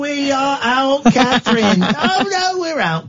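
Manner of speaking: high, drawn-out wail of defeat; hammed-up high-pitched dismay; mock wail. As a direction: wail it theatrically, as if all is lost. Mock lament at losing game.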